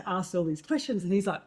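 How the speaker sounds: normal talking voice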